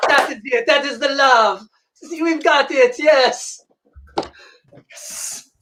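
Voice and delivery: usual speaking voice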